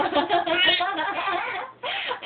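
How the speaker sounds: big loud laugh. Real, not acted. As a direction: burst of loud delighted laughter. Baby does something funny.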